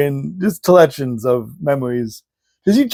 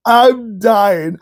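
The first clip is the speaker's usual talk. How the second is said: normal talking voice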